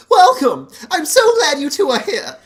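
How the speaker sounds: loud, high, beaming welcome; upbeat youth pastor. Acted. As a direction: greet them like an overeager youth pastor. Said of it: "it was just a youth pastor voice".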